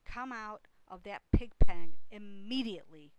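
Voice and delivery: very loud scolding order; Missus Arable's cry. A narrator's reading. Mother ordering son from pig pen, loud shout. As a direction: shout the command at a naughty child. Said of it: "cried Missus Arable".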